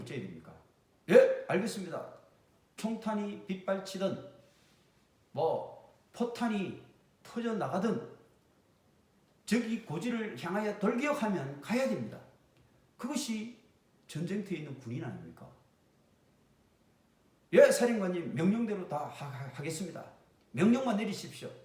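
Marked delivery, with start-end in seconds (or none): 1.1-2.1 loud, crisp yes-sir answer; soldier's loud reply. Acted. 17.5-21.5 loud eager soldier, yes sir; soldier's loud reply. Acted. Soldier answering commander.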